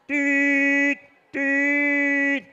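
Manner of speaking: high, held honking tone; car horn beeps. Acted. Storyteller as the car honking its horn, high beeps. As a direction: imitate two long honks. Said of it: "the car honked his horn".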